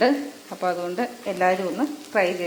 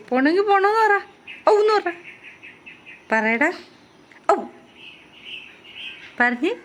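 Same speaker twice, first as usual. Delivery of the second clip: much higher, louder coaxing; 10 semitones higher, 3 dB louder than usual. Real, not acted. Owner urging her pet to say yes, high and coaxing. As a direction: urge the pet to answer in a squeaky voice.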